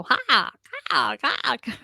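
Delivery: imitating a crow cawing. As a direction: caw like a crow. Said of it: "and it would go".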